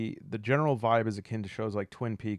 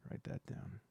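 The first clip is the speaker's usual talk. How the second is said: ordinary delivery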